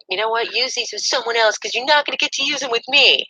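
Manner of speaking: high, cutting kiss-off line; dramatic comeback. Retold or imitated. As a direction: throw it in his face. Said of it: "And I said".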